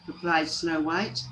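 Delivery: ordinary delivery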